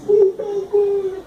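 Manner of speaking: put-on high, drawn-out childish "por favor" with a laugh; tearful child's please. Father voicing his daughter asking her mother, high and tearful. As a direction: stretch it like a little girl half crying.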